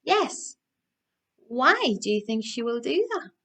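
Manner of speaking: ordinary delivery, nothing marked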